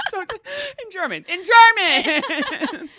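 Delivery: laughing, drawn-out fake German accent; hammed-up German accent through laughter; mock German accent. Friend asked for a German accent, laughing through it. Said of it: "say a sentence with a German accent".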